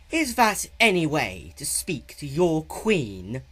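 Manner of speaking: slow, loud, looming royal threat; queen's bellow. A narrator's reading. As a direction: tower over him with every word. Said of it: "Nyx bellowed".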